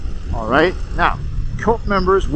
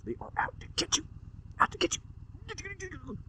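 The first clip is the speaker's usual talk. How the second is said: hushed conspiratorial murmur, much lower and softer; paranoid whisper; 8 semitones lower, 15 dB quieter, faster than usual. Acted. Warning the listener about outsiders, hushed and paranoid. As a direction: whisper like everyone is watching.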